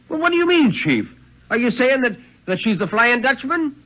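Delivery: high, urgent questioning; alarmed question. Acted. Young keeper presses his chief, high and alarmed.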